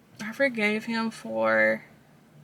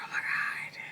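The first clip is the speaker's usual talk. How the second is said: breathless whispered shock; barely audible gasp of disbelief; stunned whisper; 11 dB quieter than usual. Retold or imitated. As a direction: whisper it, floored. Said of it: "I was like".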